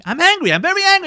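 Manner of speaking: high, loud protest of anger; angry. Acted. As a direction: insist hotly, acting it out. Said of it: "Don't get angry".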